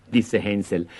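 ordinary delivery, nothing marked

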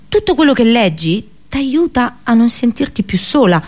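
ordinary talk

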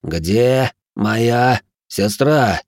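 very slow, each word separated. Acted. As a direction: space out every word, menacing. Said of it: "разделяя слова".

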